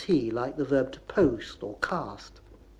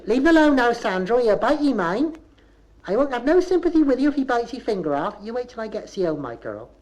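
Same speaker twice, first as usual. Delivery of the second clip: high, loud opening, tailing down; scolding; 7 semitones higher, 6 dB louder than usual. Acted. Woman warns Sandro off the biting animal, raised scolding voice. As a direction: tell the child off mid-gossip.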